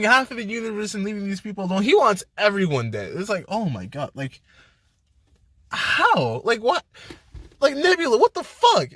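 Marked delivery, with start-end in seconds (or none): 3.5-8.9 rising disbelief. Retold or imitated. Fan yelling at past Nebula on screen, rising disbelief.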